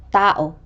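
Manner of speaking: tao with stress on the opening ta-; penultimate stress. Shown as a demonstration. Teacher repeating a penultimate example, first syllable stressed in tao. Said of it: "given to the syllable before the last".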